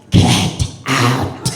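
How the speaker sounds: deep, gruff joke voice, laughing; deep vagina voice. Pretend. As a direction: throw your voice down low like a thrown-voice puppet. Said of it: "you could be like".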